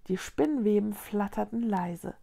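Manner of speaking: ordinary delivery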